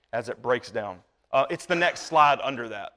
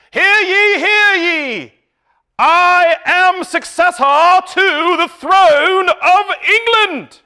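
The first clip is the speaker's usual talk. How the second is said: loud, high, slow proclamation; town crier; 13 semitones higher, 10 dB louder, slower than usual. Retold or imitated. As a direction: herald proclaiming to a crowd. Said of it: "somebody that would come out of the woodwork and say".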